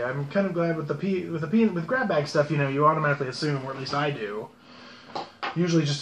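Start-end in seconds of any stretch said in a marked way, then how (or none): none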